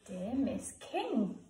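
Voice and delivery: Dear Miss King, drawn out slowly as if writing; slow dictation. Shown as a demonstration. Teacher writing the letter aloud for pupils, slow. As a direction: read it slowly while writing it down. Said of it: "teacher writes and reads aloud".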